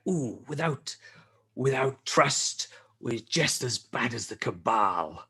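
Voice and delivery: breathy, slow, hushed delivery; mock hushed whisper. Crow quoting a grave warning, hushed whisper. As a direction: lean in and breathe it out solemnly. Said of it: "And he's like".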